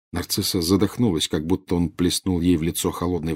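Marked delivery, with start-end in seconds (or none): none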